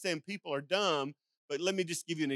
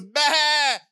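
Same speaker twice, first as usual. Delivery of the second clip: loud, high startled bleat; a panicked sheep crying out; 6 semitones higher, 11 dB louder than usual. Retold or imitated. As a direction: bleat like a scared lost sheep. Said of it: "and she goes".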